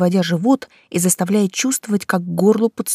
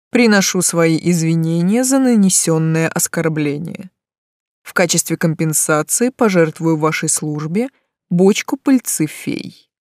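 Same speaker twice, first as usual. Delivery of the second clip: unruffled. A narrator's reading. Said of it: "невозмутимо заявил".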